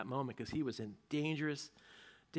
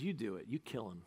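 low, quiet command; cold order; 5 semitones lower, 7 dB quieter than usual. Acted. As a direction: hand over the killing coldly. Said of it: "turns to Doeg and says".

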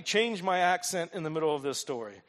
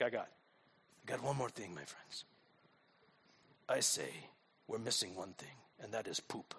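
much lower and softer, a grave hushed put-on voice; sinister leader voice; 9 semitones lower, 14 dB quieter than usual. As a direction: announce the final twist like a mob boss. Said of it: "Now, I'm gonna use accents".

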